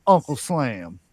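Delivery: booming, drawn-out ringmaster call. Acted. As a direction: welcome the crowd like a circus ringmaster. Circus-style show introduction.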